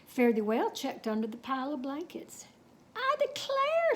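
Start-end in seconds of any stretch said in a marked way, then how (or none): 2.9-3.9 a surprised, much higher, slow exclamation; cried out. A narrator's reading. Cowgirl finding the tiny pea, surprised and much higher. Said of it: "she cried".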